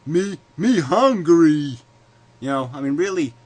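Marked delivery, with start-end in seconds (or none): 0.1-1.8 high, loud, slow caveman voice; dumb caveman grunt. Retold or imitated. Vlogger mocking the bottom feeders as grunting brutes. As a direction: bellow it like a brute with one thought. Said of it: "are only thinking, like".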